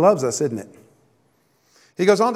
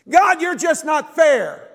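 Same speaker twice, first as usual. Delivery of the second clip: high, slow whine of a grown-up sulking at God; childish complaint; 10 semitones higher, 4 dB louder, slower than usual. Pretend. Preacher mocking forty-year-olds whining at God, high and slow. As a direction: whine it out like a four-year-old. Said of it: "in the culture from forty-year-olds".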